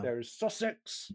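slow, put-on Sussex accent; mock Sussex accent. Shown as a demonstration. Listing British accents for friends, in a mock Sussex accent. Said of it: "variants of the British accent".

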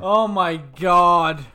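slow, loud exasperation; exasperated. Real, not acted. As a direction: drag it out in exasperation.

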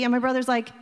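usual speaking voice

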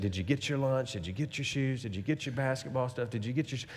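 soft, rattled-off mom checklist; quiet, repetitive mock nagging. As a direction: imitate a fussing mother under your breath. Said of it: "his mom every morning is just saying".

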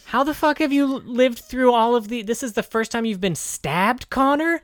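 loud, high, disbelieving yell; incredulous outburst. Real, not acted. Co-host can't believe Connor's never been stabbed, loud incredulous yell.

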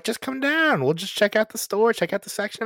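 high-pitched imitation of a coaxing pal; coaxing friend. Friend coaxing him into the store, imitated in high voice. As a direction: pitch it way up, urging someone along.

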